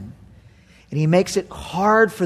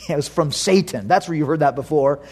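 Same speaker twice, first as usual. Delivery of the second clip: usual speaking voice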